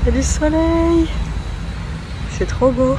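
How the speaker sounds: high, soft, charmed voice; delighted sigh. Real, not acted. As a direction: coo at the sunshine. Admiring the sun in the park.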